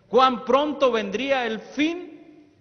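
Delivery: slow, high, loud ringing question; fervent loud question. Shown as a demonstration. Preacher repeating the prophecy with fervour, slow and loud. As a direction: lift the question up and hold it. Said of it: "repeats the question fervently".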